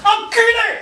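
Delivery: the word enculé shouted high and loud; tic outburst. Acted. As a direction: burst out on enculé involuntarily. Tic slips into sentence.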